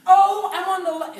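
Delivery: high, loud cheery announcement; upbeat. Retold or imitated. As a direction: imitate mom's upbeat exclamation. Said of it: "she was so upbeat".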